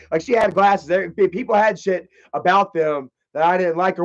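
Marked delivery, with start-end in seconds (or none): none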